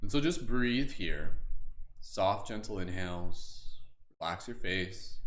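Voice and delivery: slow, gentle meditation guide voice; guided meditation. Acted. Leads water blessing meditation.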